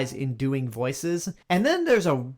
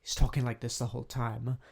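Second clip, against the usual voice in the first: softer, lower, mumbly Dolittle imitation; imitating a soft-spoken actor, much softer; 4 semitones lower, 7 dB quieter than usual. Shown as a demonstration. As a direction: drop into a soft murmur to mimic him. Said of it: "he's so soft-spoken".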